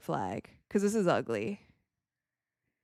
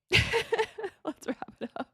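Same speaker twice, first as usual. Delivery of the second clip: slow, high words through laughter; helpless high-pitched laughing delivery; 11 semitones higher, much slower than usual. Real, not acted. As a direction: laugh your way through the phrase. Amused repeat while watching blowout.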